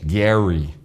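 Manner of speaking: imitating perfect English pronunciation; perfect English Gary. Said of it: "falava perfeitamente".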